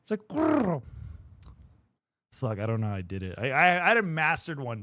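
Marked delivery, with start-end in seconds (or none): 0.3-0.8 much higher, a quick trilled cat growl; rolled-R growl. Shown as a demonstration. Streamer to chat, showing her lost R-roll, trilled growl. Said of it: "tongue rolling for cat sounds".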